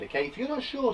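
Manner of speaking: normal talking voice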